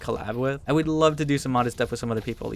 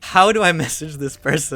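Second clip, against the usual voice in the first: loud, high exasperated question; 4 semitones higher, 6 dB louder than usual. Retold or imitated. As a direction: throw up your hands, baffled. Frustrated inner thought quoted aloud.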